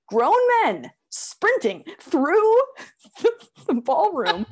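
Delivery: high, slow, laughing disbelief as each word stretches out. Real, not acted. To her co-host, describing grown men sprinting, high and laughing. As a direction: let the laugh break into the words.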